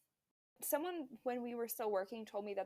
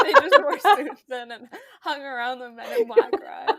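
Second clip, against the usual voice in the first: loud, higher, talking through laughter; laughing; 5 semitones higher, 17 dB louder than usual. Real, not acted. Host joking about fake park characters, laughing hard. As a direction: crack up while getting the words out. Cracking up at the joke.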